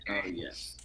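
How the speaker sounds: whisper. Real, not acted.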